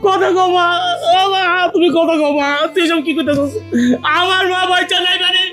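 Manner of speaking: wailing grief. Acted. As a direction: cry out to the dead mother. Son learns mother is dead.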